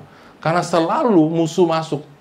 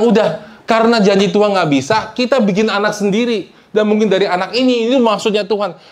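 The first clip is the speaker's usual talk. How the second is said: lightly voiced wife, higher and louder; voicing Sarah; 7 semitones higher, 4 dB louder than usual. Preacher acts Sarah urging Abraham, raised voice. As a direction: plead it like an impatient wife. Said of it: "dia bilang".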